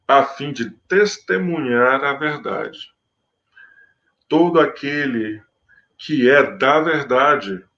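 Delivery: measured, solemn Bible reading; scripture reading. Acted. Preacher reading the Gospel to his listeners, solemn. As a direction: read the passage to the congregation with gravity.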